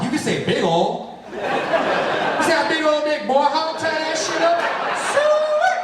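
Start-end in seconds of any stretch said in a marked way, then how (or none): 1.3-4.6 high, slow Southern drawl with a laugh. Retold or imitated. Wife growing horny, slow high Southern drawl. 5.1-5.8 squeaky high squeal. Retold or imitated. Wife at her most horny, a high squeal.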